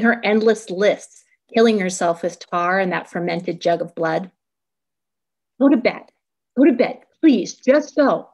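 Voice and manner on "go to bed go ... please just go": higher, louder pleading; begging. A narrator's reading. Daughter begs drinking mother to go to bed, pleading. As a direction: plead with rising urgency, louder. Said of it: "I begged".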